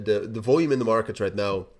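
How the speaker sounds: ordinary talk, nothing marked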